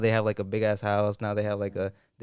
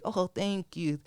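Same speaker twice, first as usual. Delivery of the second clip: put-on high, soft frail old woman; old lady voice; 5 semitones higher, 3 dB quieter than usual. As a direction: thank him like a sweet granny. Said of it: "in her old lady voice".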